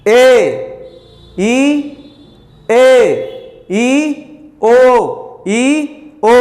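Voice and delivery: held vowels ई, ए, ओ with the jaw opening; vowel demo. Shown as a demonstration. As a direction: sound out ई, ए, ओ one by one. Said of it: "तो देखिए ई".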